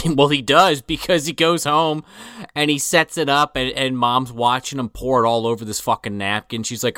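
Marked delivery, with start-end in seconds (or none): none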